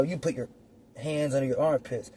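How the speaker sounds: usual speaking voice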